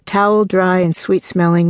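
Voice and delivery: ordinary delivery, nothing marked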